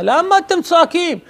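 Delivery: high-pitched protesting question; indignant priest. Acted. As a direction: challenge them, pitched well up. (שאלה נרגזת בקול גבוה)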